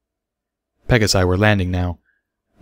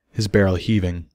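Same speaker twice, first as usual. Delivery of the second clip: plain reading voice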